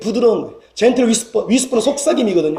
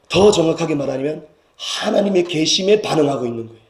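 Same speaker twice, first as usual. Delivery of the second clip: ordinary talk, nothing marked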